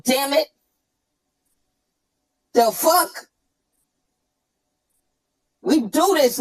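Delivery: usual speaking voice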